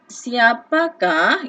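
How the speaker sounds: pointing out the question word slowly; slow stressed demo. Shown as a demonstration. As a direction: spell out "Siapakah" slowly and firmly. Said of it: "ini adalah kata tanya".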